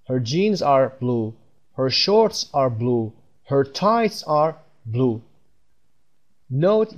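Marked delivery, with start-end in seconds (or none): 0.1-5.2 each sentence spoken very slowly; slow drill. Shown as a demonstration. Teacher drilling example sentences for learners, very slow. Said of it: "بریم پایین مثال".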